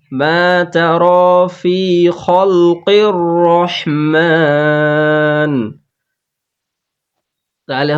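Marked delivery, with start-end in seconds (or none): 2.2-5.5 slow chanted tajweed recitation; Quran recitation. Shown as a demonstration. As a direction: chant it slowly with the long vowels held. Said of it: "এখানে তিন জায়গাতে টান আছে".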